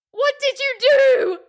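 very high, slow wail of accusation; frantic accusing cry. A narrator's reading. Mother shakes her daughter and blames her, frantic cry. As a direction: cry it out, drawing the words long.

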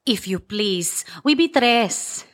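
put-on slow, Spanish-accented broken English; halting Spanish-accented voice. One voice plays second stranger.

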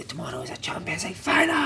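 half-whispered mock shout; retold shout, hushed. Manager in the gym on a Friday, whispered imitation of shout. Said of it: "He'd shout on a Friday".

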